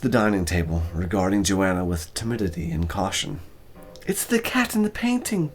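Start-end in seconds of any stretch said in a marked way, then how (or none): 4.1-5.5 put-on high, amazed young woman; young woman recognizing the cat; Joanna's voice. A narrator's reading. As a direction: voice her realizing, wide-eyed. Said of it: "Joanna said".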